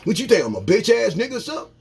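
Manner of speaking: fast, rising deflection; mock defensive gossiper. Gossiper dodging the question, fast and rising. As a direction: rush into a fight to dodge.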